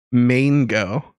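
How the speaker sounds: "Mango" stretched to "MAYN-go"; exaggerated American pronunciation. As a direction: bend "Mango" toward "main-go", rising. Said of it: "Now he's saying mango".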